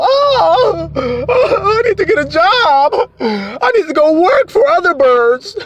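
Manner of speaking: very high, loud mock wailing, slow; crying bird. Preacher mocking a bird crying for a job, wailing high. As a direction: wail like a desperate little bird. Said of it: "The bird ain't crying on the branch going".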